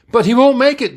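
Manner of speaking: high, loud protest; alarmed yell. A narrator's reading. Officer fearing for Sulu, alarmed yell. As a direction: yell out in distress. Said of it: "Dickerson yelled".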